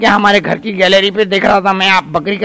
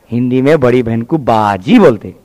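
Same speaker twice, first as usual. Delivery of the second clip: tutor voice; 9 semitones lower than usual. Acted. Tutor explains a Hindi word to the boy, lower and patient.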